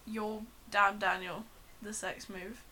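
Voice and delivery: ordinary delivery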